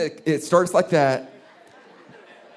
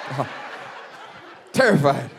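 ordinary delivery

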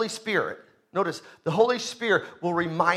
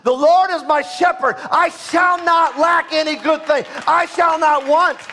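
high, loud preacher shout; full force preaching; 5 semitones higher, 4 dB louder than usual. Acted. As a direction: drive it home like a revival preacher. Preaching climax with scripture.

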